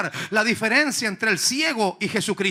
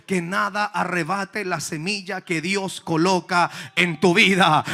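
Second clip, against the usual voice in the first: ordinary delivery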